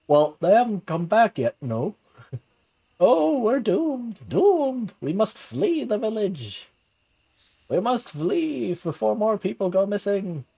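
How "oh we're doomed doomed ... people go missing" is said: high, slow wail of doom; panicking villager. Acted. Frightened villager crying out to the crowd, high and slow. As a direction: moan that all is lost.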